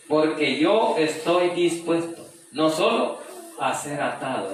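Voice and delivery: slow, high, loud pulpit declaration; preacher proclaiming to the congregation; fervent preaching. Acted. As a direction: declare Paul's resolve with full conviction. Verse proclaimed again.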